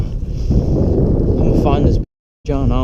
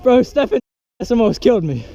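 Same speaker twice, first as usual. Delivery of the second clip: excited; 8 semitones higher than usual. Real, not acted. Excited after near crash.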